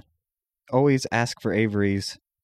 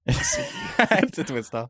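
high, slow teasing repeat; mocking echo; much slower than usual. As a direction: echo him back in a slow, high tease.

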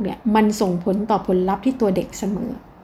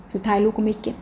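ordinary delivery

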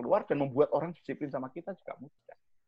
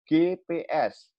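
spelled out slowly; much slower than usual. Shown as a demonstration. Spelling out the acronym GPS.

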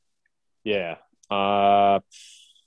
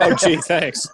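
sarcastic, laughing thanks; mock thankful, half laughing; fake gratitude; 5 semitones higher, slower than usual. Sarcastic reply to harsh advice.

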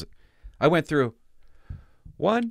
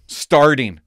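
emphatic read; 4 semitones higher, 10 dB louder than usual. Real, not acted. Reading an assessment aloud, emphatic.

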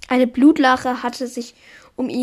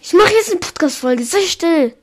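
annoyed; 4 semitones higher, 3 dB louder than usual. Real, not acted. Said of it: "Das irritiert mich".

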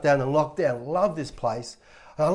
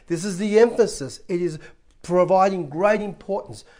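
ordinary talk

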